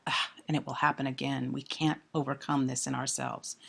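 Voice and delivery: low, soft, resigned sigh; despair. Acted. Lecturer voices the speaker's resignation, quiet despair. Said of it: "So that despair".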